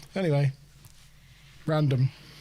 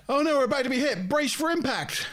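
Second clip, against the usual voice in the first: crew shouting brace; 8 semitones higher than usual. Acted. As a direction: play a ship captain badly. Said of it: "It was terrible".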